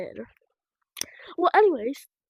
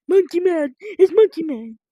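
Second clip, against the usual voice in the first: excited; 3 semitones higher, 9 dB louder than usual. Real, not acted. Spots the character, calls out.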